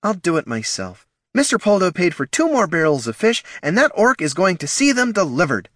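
irritated gnoll. A narrator's reading. Gnoll insisting angrily.

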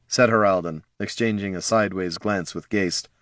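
ordinary delivery

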